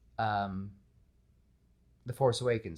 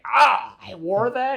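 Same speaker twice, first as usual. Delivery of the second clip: put-on high, loud gasp at old clothes; mock shocked realization; 12 semitones higher, 10 dB louder, slower than usual. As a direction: cringe out loud at the photo, much higher. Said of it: "and you're like".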